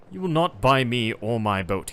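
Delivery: loud and slow, the captain stands his ground; firm proud refusal. Acted. Captain refusing the traveller's offer, a loud slow proud refusal.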